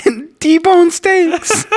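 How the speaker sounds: very high-pitched, drawn-out whining; slow, wailing small-child impression; whiny little boy. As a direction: whine it way up high, dragging the words. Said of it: "doing a whiny little".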